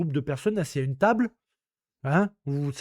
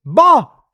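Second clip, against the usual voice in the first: a loud dismissive buzzer Bah; loud mock buzzer; 4 semitones higher, 10 dB louder than usual. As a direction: buzz the contestant out. Buzzer moqueur d'élimination. («Bah !» fort comme un buzzer)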